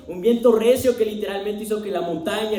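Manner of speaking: ordinary talk, nothing marked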